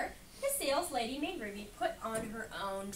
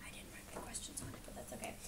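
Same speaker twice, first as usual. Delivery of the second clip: much softer, breathy whisper to herself; whispered aside; 16 dB quieter, faster than usual. Real, not acted. Reader admitting she forgot her questions, whispered. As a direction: murmur it under your breath.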